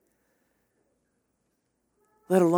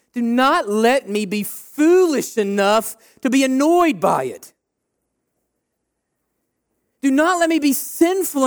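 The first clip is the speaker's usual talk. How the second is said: higher, louder pleading prayer, slowing on the thanks; fervent prayer; 6 semitones higher, 4 dB louder than usual. Acted. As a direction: cry out to God with rising passion. Passionate prayer at sermon's end.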